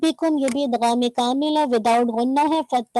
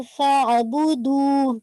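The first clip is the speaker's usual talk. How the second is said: "फअबुदू" very slow, fatha clear, then merged into ain; slow demonstration; much slower than usual. Shown as a demonstration. Teacher slowly models the word for the student. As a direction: stretch "फअबुदू" syllable by syllable. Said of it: "पहले फतह को वाज़े कीजिए".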